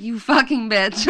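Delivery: imitating a pranked dad, slow; drawn-out, half-amused retold curse; dad realizing he's been had, savoring each word; mock outrage. Dad reacting to being pranked.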